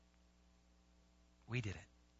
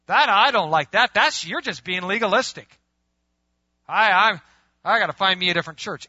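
indignant objection; 4 semitones higher, 9 dB louder than usual. Acted. Acted churchgoer objecting.

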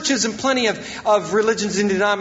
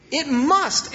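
"must" raised in pitch and leaned on; stress on must; 5 semitones higher than usual. Real, not acted. Preacher on what service requires, stressing "must". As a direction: land hard on the word "must". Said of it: "I emphasize the word must".

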